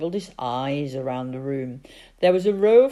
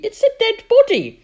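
put-on high, loud panicked girl's cry; shouting in alarm; 11 dB louder than usual. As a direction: shriek the discovery in shock. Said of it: "shouted Sophie".

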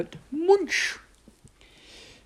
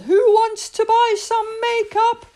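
high, louder hawker's cry; calling out; 6 dB louder than usual. Acted. Julie calls out the window to the neighbourhood girls, loud and high. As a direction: call to the whole street. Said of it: "leaned out the window and yelled".

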